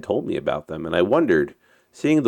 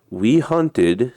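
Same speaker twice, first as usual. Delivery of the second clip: firm chief, pitched up; lightly voiced chief in a story; 5 semitones higher than usual. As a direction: answer back as the tribe's chief, higher.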